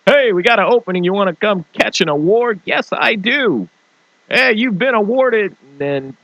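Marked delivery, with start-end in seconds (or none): 0.1-2.6 high, louder hammed-up invitation; mock event host. 2.7-5.6 high, slow hammed-up award voices; mock award exchange.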